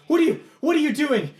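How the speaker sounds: very high, loud demand; panicked shout. Acted. Knight dragged towards the circle, panicked shout. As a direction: cry out in alarm, voice way up.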